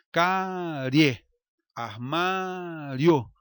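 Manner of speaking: armário stretched out very slowly; slow demo words. Shown as a demonstration. Teacher to students showing a rising diphthong, slowly drawn out. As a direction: drag armário out slowly so each sound shows. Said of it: "Note que o i é uma semivogal".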